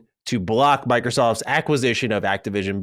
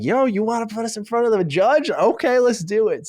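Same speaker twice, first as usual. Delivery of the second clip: higher-pitched, eager challenge; acted excitement; 10 semitones higher than usual. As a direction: voice a team keen for the fight. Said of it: "there is excitement across all the buildings".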